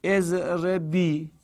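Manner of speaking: اضربی drawn out slowly, syllable by syllable; slowly spelled out. Shown as a demonstration. Teacher dictating اضربی slowly to students. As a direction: stretch اضربی long and slow for the class. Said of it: "تضربینه رو هم بگو".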